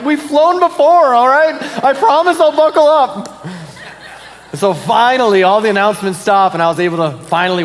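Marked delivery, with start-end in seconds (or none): 0.0-3.5 high, louder, slow fed-up whine; mock exasperation. Passenger pleading with flight crew to stop announcements, mock exasperated. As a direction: plead with the crew in hammed-up annoyance.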